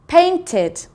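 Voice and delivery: PAINTED in two syllables, ending in ID; teacher modelling the ID at the end of painted. Shown as a demonstration. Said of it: "id at the end".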